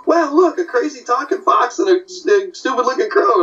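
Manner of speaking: high-pitched cartoon wonder; amazed turtles. Turtles meeting the fox and crow, amazed. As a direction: marvel in a high put-on turtle voice. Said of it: "both of them are like".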